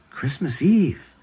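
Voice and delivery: ordinary delivery, nothing marked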